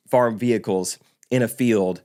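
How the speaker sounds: normal talking voice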